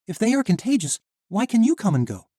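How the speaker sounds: put-on high, loud young heroine; defiant young woman. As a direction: stand up to the guard. Misha argues with the knight.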